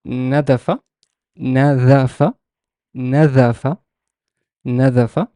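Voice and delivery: nadafa sounded out carefully, rising at the end; teacher's careful nadafa, vowels emphasised; clear, stressed vowels. Shown as a demonstration. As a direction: model nadafa with exaggerated vowels. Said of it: "I kind of stress some vowels more than others".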